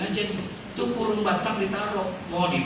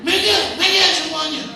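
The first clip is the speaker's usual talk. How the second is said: high, louder scolding shout; retold yelling; 9 semitones higher, 4 dB louder than usual. Widower scolds the mourners, high loud shout. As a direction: shoo everyone away loudly. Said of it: "Semuanya diomelin sama dia".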